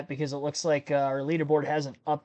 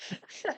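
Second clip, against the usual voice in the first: breathy giggle; helpless giggle; 12 dB quieter than usual. Real, not acted. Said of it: "couldn't even get it out without laughing".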